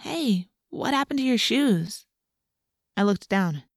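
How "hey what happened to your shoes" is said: high, slow call without much volume; drawn-out call, lightly voiced. A narrator's reading. Friend calling from the car after a girl, calling out. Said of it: "I heard Melissa call out to me".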